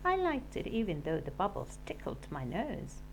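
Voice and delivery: plain reading voice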